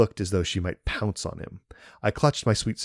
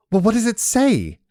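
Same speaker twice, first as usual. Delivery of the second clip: high, loud, impatient near-scream; almost screaming; 8 dB louder than usual. A narrator's reading. Yumi almost screaming at the steward to read the will. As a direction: demand it right now, nearly shrieking. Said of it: "almost screaming at the man".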